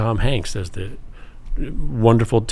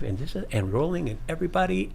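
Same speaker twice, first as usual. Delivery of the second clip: much softer, a mock hushed "rolling"; quiet director's call; 6 semitones higher, 7 dB quieter, faster than usual. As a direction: call action as a soft-spoken director. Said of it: "that's how loud he was too".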